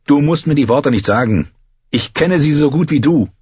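mildly angry, high retort; getting angry. A narrator's reading. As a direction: snub them with rising irritation. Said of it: "Er wurde böse".

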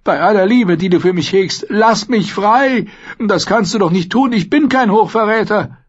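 high, loud frantic pleading; desperate. A narrator's reading. Prisoner begging Sir Axel for release, desperate. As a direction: beg frantically for your freedom. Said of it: "sagte der Gefangene verzweifelt".

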